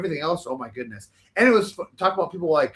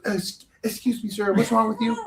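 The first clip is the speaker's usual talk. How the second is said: flamboyant cabin manager; 6 semitones higher than usual. Retold or imitated. Cabin manager confronting a sick passenger, flamboyant and high-pitched. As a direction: imitate a flamboyant man, pitched up and affronted. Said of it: "he's very flamboyant too".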